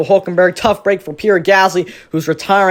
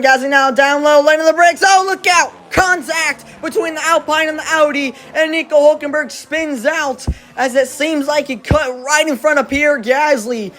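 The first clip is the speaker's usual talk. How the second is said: excited commentator; 7 semitones higher than usual. Acted. Live crash call in race.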